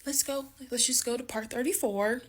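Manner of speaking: Let's go pitched far up, then a breezy Let's just go to part thirty-four. Real, not acted. Cutting off her own angry story to move to part thirty-four, voice jumps up. Breaking off the story mid-rage.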